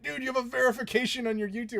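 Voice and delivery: a hint of a wide-eyed fan; a young fan amazed at the badge, lightly voiced; starstruck kid. Starstruck kid quoting verification badge.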